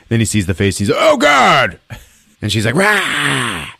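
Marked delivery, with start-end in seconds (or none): 1.0-1.8 high, loud mock-terrified cry; horrified yell. Man seeing her face, horrified yell. 2.7-3.7 high mock monster cry; witch's snarl. Witch to the man, mock attacking cry.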